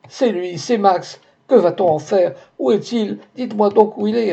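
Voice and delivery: Geneviève begs the porter for news of Max, frantic; beside herself. A narrator's reading. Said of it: "répéta Geneviève hors d'elle".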